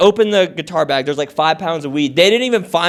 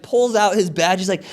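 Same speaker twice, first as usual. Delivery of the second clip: normal talking voice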